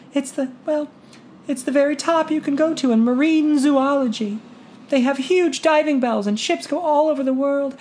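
put-on high, hesitant young woman's voice; a timid girl finally daring to hope; shy daughter. A narrator's reading. Shy daughter telling her father her dream, high and hesitant.

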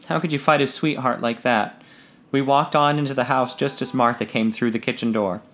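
ordinary delivery

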